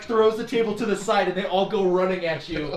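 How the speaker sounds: ordinary talk, nothing marked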